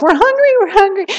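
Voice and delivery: put-on very high, whining child voice; whiny kids. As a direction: pester like a kid grabbing candy. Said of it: "your kids are hanging on you".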